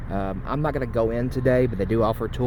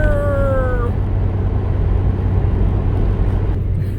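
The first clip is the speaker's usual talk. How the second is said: laughing; 7 dB louder than usual. Real, not acted. Laughs after shouting dinosaur.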